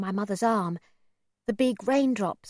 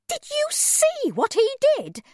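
shocked shriek; 5 dB louder than usual. A narrator's reading. Mother shrieks to her son as the man steals an umbrella. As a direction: shriek it in disbelief. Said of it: "my mother shrieked".

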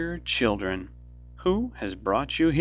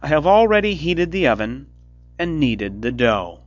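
loud, higher scheming witch; 6 semitones higher, 9 dB louder than usual. A narrator's reading. Witch luring Gretel to the oven, loud urging. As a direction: press the girl toward the oven. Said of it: "said the old woman".

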